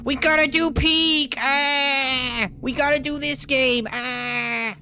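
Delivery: high, squealy excited shouting; excited high whine. Retold or imitated. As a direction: gush like an eager kid begging. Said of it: "indirectly mentioning you earlier with the whole".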